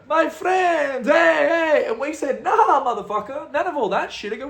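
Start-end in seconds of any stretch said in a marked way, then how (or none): 0.1-1.8 loud, high host's welcome; imitated hearty greeting. Club fixer pops out to greet his guests, shouted welcome. 2.4-4.3 high-pitched, fired-up protest; retold indignant refusal. Cornered tourist confronts the club fixer, indignant.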